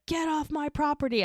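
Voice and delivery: high-pitched mock angry outburst; retold furious order. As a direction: brother throwing his sister's lover out, mock furious. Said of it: "I've never seen him that mad before".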